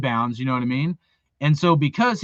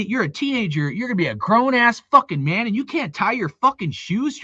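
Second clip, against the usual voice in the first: ordinary delivery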